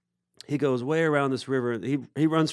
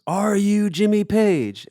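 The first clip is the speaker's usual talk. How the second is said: high, loud, drawn-out mock call; imitated insistent holler; 10 semitones higher, 6 dB louder, slower than usual. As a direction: draw out each word loudly. Edwin asks one last time.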